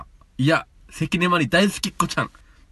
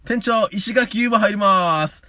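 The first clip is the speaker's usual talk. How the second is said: host club call; 7 semitones higher, 4 dB louder, slower than usual. Acted.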